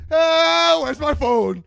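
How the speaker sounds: frantic yelp of someone who lost a phone; panicked. Acted. Patting his pockets for a missing phone, loud panicked cry.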